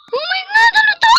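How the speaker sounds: high, terrified shriek; frightened scream. Real, not acted. Scared by something in the game.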